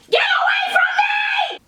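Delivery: piercing high scream at full volume. Retold or imitated. Old lady screaming at the man in the aisle, high shriek. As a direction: shriek it at the top of your lungs. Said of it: "She literally screamed at the top of her lungs".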